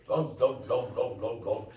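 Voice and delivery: lightly voiced dull grunting; mock big guy talk. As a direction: mumble dum dum like a slow big man. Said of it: "he talks like that".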